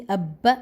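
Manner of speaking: "اب" as abba with a held double b; joined, geminated b in "اب"; pressed doubled consonant. Shown as a demonstration. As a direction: press the b of "اب" before releasing it into a. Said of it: "اسی طرح ہی".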